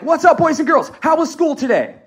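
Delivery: loud, high, pumped-up class greeting; high energy. Shown as a demonstration. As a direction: greet the kids with booming enthusiasm. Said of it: "What is their energy gonna be?".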